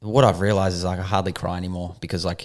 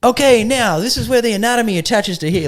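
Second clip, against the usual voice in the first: much higher, brisk lecturer voice; pitched-up teacher, moving straight on; mimicking the teacher; 6 dB louder than usual. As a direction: imitate him snapping back to the lesson. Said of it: "like, went".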